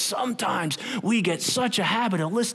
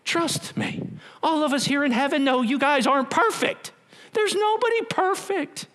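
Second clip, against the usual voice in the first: higher, gentle voice from heaven; Holy Spirit voice; 10 semitones higher than usual. Acted.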